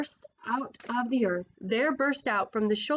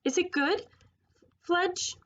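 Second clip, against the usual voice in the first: much higher, rising character voice; high-voiced question; 8 semitones higher than usual. A narrator's reading. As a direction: ask it high and lifted. Misvoiced line, restarted after sorry.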